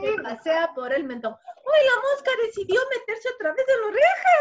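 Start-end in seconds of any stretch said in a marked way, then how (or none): 1.3-4.3 high, loud, quick playful squeal; mock alarm. Teacher warns children the fly enters the ear, excited. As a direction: build ticklish excitement for children.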